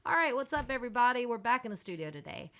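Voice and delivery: casual radio host. Retold or imitated. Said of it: "when does it go into".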